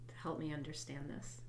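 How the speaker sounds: normal talking voice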